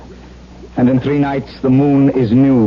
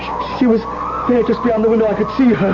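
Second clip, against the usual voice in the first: higher, breathless, still frightened; shaken insistence; 13 semitones higher than usual. Acted. Husband telling his cousin about the ghost, much higher and shaken. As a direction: insist you saw her, still shaking.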